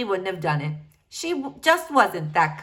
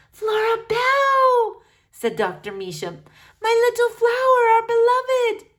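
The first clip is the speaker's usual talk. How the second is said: put-on effusive old doctor; 14 semitones higher, 5 dB louder than usual. Doctor welcoming Flora at her door, gushing. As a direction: gush over her like a doting aunt.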